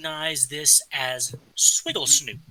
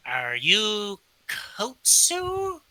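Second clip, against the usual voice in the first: very slow, higher, drawn-out voice; slow kobold question; 6 semitones higher, much slower than usual. Acted. Kobold sizing up the guild member, slow and drawn out. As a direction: size him up slowly as you ask. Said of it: "slowly looks up at you and goes".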